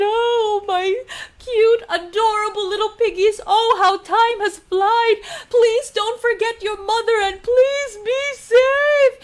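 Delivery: high, loud, hammed-up sobbing goodbye; hammed-up crying mother; mock crying farewell. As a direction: wail like a mother losing her babies. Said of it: "she started to cry and cry".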